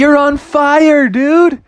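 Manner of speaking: high, stretched surfer cheer. Acted. Frogs to surfing shark, high excited cheer. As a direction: cheer him on, loud and high.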